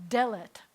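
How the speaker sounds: "delet" said DE-let, loud first syllable; stress on first syllable. Shown as a demonstration. Teacher models Hebrew segolate stress on first syllable. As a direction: punch the "de" in "delet". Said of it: "accent's gonna be on the first syllable".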